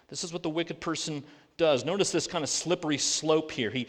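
usual speaking voice

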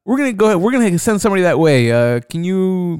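a lightly voiced call-center dispatcher, slowing down on can you; imitating dispatcher. Dispatcher tells caller help is coming, in a mock dispatcher voice.

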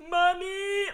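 hammed-up wail breaking into tears; a brave boast collapsing into crying for mommy; mock wail. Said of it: "dissolves immediately into tears".

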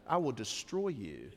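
low, soft mock threat to the kids; a hint of a parent's silent threat; quietly menacing mock threat; menacing parent look. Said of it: "It's just the look".

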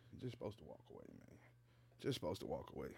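walk off from the mic while talking; walking away from the mic. Shown as a demonstration. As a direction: voice fading into the distance. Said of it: "I just had to demonstrate".

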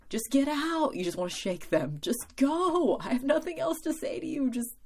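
exasperated friend begging them to walk away; exasperated urging. Retold or imitated. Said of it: "and just be like".

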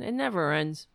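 normal talking voice

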